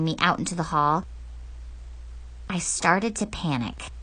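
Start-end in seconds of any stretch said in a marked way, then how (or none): none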